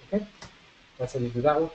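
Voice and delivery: ordinary talk, nothing marked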